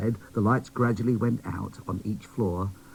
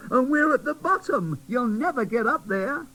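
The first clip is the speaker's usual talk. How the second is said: much higher, fretful fairy voice; fairy scolding the new doll, high; tattered fairy; 3 dB louder than usual. Acted. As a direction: worry at him. Griselda objects.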